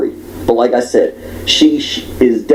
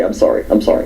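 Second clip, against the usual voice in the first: ordinary delivery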